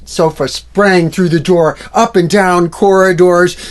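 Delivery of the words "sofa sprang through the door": plain reading voice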